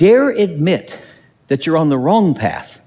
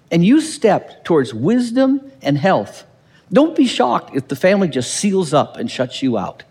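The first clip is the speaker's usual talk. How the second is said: ordinary talk, nothing marked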